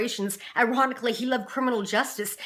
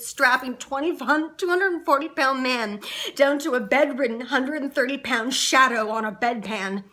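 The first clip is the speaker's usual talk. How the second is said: usual speaking voice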